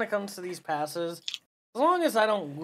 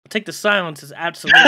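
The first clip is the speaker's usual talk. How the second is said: laughing; 11 dB louder, faster than usual. Real, not acted. Laughing at silent co-hosts.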